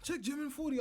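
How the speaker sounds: high mock voice of a nagging chat viewer. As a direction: parrot a pestering stream viewer in a silly high voice. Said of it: "someone like".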